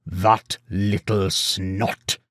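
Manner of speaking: very slow, sour emphasis; sounding out each word. A narrator's reading. Farmer on the disloyal goatherd, each word sounded out. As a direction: space out every word with disgust. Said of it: "sounding out each word".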